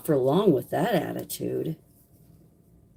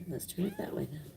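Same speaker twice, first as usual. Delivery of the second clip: ordinary talk, nothing marked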